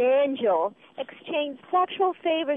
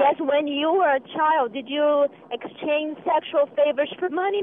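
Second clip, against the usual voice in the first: ordinary talk, nothing marked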